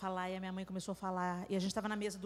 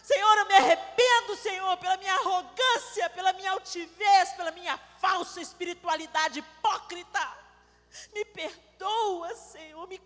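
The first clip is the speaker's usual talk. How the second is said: loud, high fervent sermon voice; preacher in full flow, raised and intense; fervent preaching; 9 semitones higher, 9 dB louder than usual. Acted. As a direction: proclaim it to the congregation with fire. Preaching repentance at full force.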